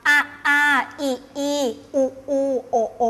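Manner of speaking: อ้าปากกว้าง ออกสระ "อะ อา อิ อี" ทีละเสียงชัดๆ; ออกสระแต่ละตัวตามรูปปากอย่างตั้งใจ; wide-mouth vowel drill. Shown as a demonstration. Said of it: "เอาคำที่ ออกสระ". (ครูสาธิตการออกสระโดยอ้าปากกว้าง)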